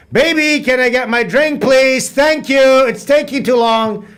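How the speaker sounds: high, sing-out demand for service; mock-impatient high call, ringing like a bell; calling out impatiently. Mock impatient call to partner for drink.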